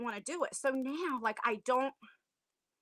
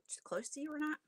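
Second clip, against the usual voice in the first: whispered repeat; 3 semitones higher, 10 dB quieter, faster than usual. Real, not acted. Asks, then repeats whispered.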